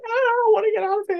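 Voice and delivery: high-pitched panicky patient; mock panic. Patient lying in the dentist's chair, mock panic. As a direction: squeal it in mock desperation.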